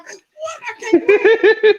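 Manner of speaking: high laughing wail; laughing complaint. Retold or imitated. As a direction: whine it through laughter, slowly.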